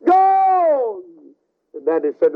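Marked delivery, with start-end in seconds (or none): 0.0-1.0 high, loud yell; frantic cry to flee the flood; urgent shout. Acted. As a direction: shout it as a desperate command.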